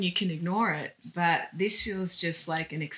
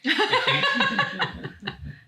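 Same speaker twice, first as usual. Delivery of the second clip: a big, high burst of laughter; loud laugh; 4 dB louder than usual. Real, not acted. Friend laughing at the doctor's obvious remark, loud high laugh.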